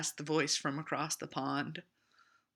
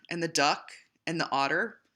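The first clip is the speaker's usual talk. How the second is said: usual speaking voice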